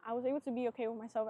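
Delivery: fast, light restatement; fast casual repeat to the camera; quick repeat. Real, not acted. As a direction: rattle "I was able to be okay with myself" off quickly.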